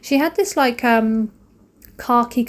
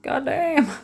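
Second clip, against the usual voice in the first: exasperated goddamn; 5 semitones higher, 5 dB louder, much slower than usual. Retold or imitated. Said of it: "so I'm like".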